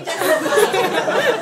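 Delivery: slow, loud, laughing hard; laughing too hard to hold it in; stretched laughing. Retold or imitated. Scolding friend while cracking up, laughing loud.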